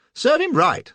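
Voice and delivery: hearty exclamation. Acted. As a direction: bluff approval. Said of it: "says I".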